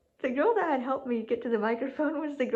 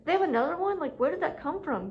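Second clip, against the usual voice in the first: retold bafflement; 6 semitones higher than usual. Retold bafflement at second cross.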